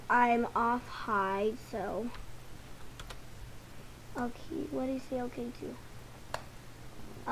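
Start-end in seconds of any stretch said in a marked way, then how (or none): 4.2-7.3 muttering. Real, not acted. Puzzled mutter under breath.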